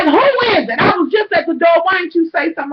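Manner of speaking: retold exasperated holler; exasperated yell. Exasperated shouting at repeated knocking.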